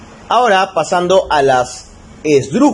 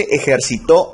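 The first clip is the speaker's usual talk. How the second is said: "ejercitó" with the stress on final TÓ; stress on last syllable. Shown as a demonstration. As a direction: put the weight at the end of "ejercitó". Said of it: "lleva la sílaba tónica en la última". ("ejercitó" acentuado en la última sílaba, TÓ)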